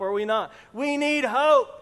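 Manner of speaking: ordinary delivery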